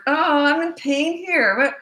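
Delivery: put-on high, slow, drawn-out child's whine; milk it like a manipulative four-year-old; loud, hammed-up wounded wail; mock wailing child. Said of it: "they'll try anything to get you".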